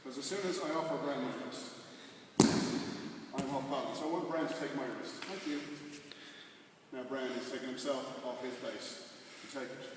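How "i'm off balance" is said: normal talking voice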